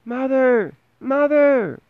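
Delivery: very high, thin cries for mother; tiny Tom calling from inside the cow; tiny boy calling out. A narrator's reading. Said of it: "he called out loudly".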